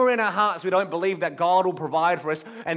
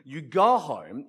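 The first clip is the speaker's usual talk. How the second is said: ordinary delivery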